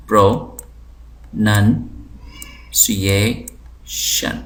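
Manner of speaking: پروننسیشن drawn out very slowly, syllable by syllable; broken into syllables. Shown as a demonstration. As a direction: stretch پروننسیشن into separate slow syllables. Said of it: "اگر ہم ٹکڑے کریں". (استاد پروننسیشن کو بہت آہستہ ٹکڑوں میں بولتے ہیں)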